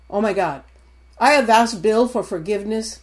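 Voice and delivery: ordinary delivery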